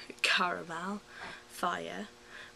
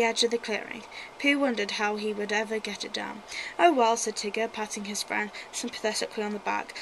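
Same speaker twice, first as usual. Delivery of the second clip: put-on reading a children's story aloud; lively storybook reading; story narration; 6 dB louder than usual. Reads a Winnie the Pooh story.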